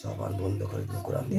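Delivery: whispered recitation. Real, not acted. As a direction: whisper it under breath. Exorcist quietly recites. (ফিসফিসিয়ে)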